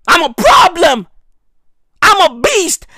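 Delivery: loud and high, boasting like a wild beast. Acted. Comedian playing the minister, loud beast boast. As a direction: bellow it, proud of being trouble.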